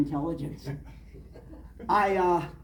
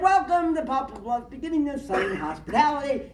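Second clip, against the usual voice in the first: higher, grand announcing tone; billboard announcer; 8 semitones higher than usual. Retold or imitated. Quoting the town billboard to a listener, grand announcing tone. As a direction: read the sign like a proud welcome.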